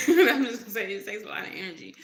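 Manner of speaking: quick, loud laugh through the words; amused at yourself, loud and rushed. Real, not acted. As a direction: burst out laughing while talking. Laughs at own stumble for words.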